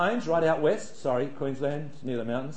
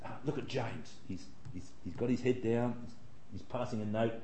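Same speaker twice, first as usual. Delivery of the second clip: teacher's aside; 3 semitones lower, 9 dB quieter than usual. Retold or imitated. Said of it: "and you notice".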